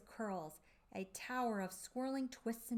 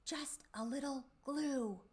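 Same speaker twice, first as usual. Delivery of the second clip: whispered secret; 6 semitones higher than usual. A narrator's reading. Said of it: "Miss Moose winked and then whispered".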